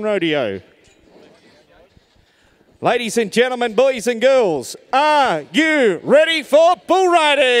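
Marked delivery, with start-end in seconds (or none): 2.8-7.6 slow, drawn-out crowd hype call; overbright kids party host. Acted. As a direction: stretch it out to rev up the crowd. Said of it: "Let's do that one more time".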